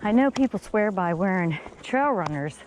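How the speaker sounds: ordinary talk, nothing marked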